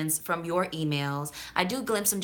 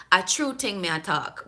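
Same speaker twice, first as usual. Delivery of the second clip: Jamaican patois phrase; Jamaican accent; 3 semitones higher than usual. Acted. Vlogger quoting the emailer before translating, Jamaican accent. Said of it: "trying to deliver it in the way that she wrote it".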